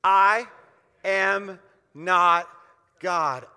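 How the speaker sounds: each word spaced and called out loud; rallying loud chant; loud call and response. Shown as a demonstration. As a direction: lead the congregation, I ... am not ... God. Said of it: "Can you say that with me?".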